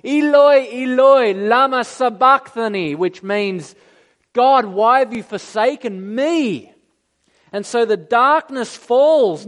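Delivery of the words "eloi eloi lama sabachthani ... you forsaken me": high, loud anguished cry of Eloi; a preacher voicing Jesus' loud cry from the cross. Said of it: "cried out in a loud voice".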